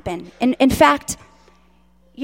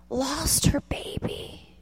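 tearful whisper; 3 semitones higher, 9 dB quieter, slower than usual. Real, not acted. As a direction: voice gives out to a whisper. Speaker weeping.

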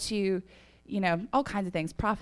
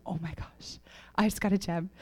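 hushed, lower gasp of disbelief; awed freak-out; 3 semitones lower, 6 dB quieter than usual. Retold or imitated. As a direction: retell amazement in a very soft voice. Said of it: "He's freaking out".

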